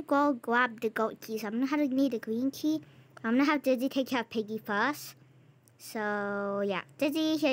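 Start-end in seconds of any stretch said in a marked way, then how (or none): none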